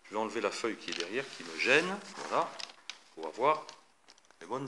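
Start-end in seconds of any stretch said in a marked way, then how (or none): none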